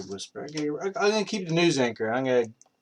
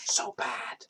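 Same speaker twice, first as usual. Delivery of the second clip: whisper; 6 dB quieter than usual. Real, not acted. Whispered self-criticism.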